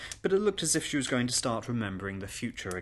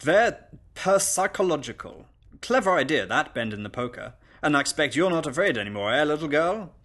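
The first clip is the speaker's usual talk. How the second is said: loud, higher, approving party guest; hearty guest; 4 semitones higher, 5 dB louder than usual. Acted. Guest praises governess and quizzes child, loud and hearty. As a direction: stumble grandly over "per-psychological".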